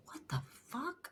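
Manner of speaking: high, soft, slow shocked gasp; staring at her own photo, stunned and hushed; stunned disbelief. Retold or imitated. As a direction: breathe it out, floored by the photo. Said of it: "I literally went".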